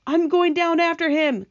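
high, loud announcement; determined cry. Acted. James leaps to rescue the centipede, determined cry. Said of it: "cried James".

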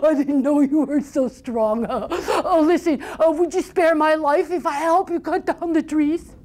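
high troll voice laughing through a plea; nervous laughing troll. Acted. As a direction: chuckle nervously and beg for your life.

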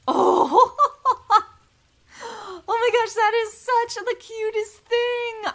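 high, loud delighted squeal with a laugh; excited squeal. Real, not acted. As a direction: squeal over something adorable. First sight of the cute pet.

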